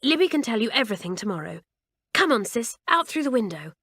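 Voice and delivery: fast, urgent hurry; hasty. Acted. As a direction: rush the words out, no time to lose. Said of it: "he said hastily".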